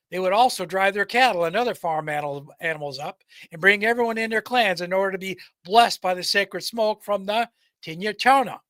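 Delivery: ordinary delivery, nothing marked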